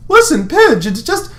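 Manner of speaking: high, rushed plea; pleading Lance. Acted. Lance pleading with Pidge, high and hurried. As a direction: try to talk your way out, high.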